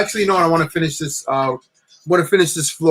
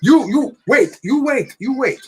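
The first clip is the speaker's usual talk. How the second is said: mock Italian cop; 8 semitones higher than usual. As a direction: order the driver to stay put, in a hammed-up cop voice. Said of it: "And then he says".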